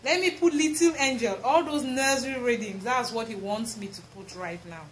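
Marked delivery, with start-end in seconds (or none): none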